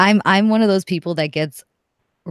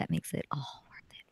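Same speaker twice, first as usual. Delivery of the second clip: whispering; 16 dB quieter than usual. Real, not acted. Whispered punchline after laugh.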